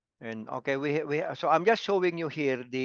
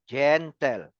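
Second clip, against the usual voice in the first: GEN and TEL split into two clear beats; syllable by syllable. Shown as a demonstration. Teacher correcting the run-together word, said syllable by syllable. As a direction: pull the word apart as GEN, pause, TEL. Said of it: "That is two syllables".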